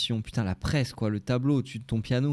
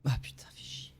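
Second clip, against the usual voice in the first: whispered curse; 9 dB quieter than usual. Real, not acted. Muttering frustration.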